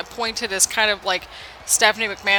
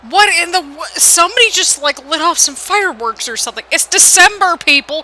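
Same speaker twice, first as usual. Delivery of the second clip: higher and louder, startled outrage at the noise; real exasperated shout; 7 semitones higher, 8 dB louder than usual. Real, not acted. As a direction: yell at the neighbours' fireworks. Fireworks set off outside.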